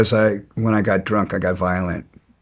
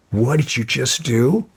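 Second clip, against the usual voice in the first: retold challenge, slightly raised; first demand, still holding back; indignant question; 3 semitones higher than usual. Confronting man who kicked his dog.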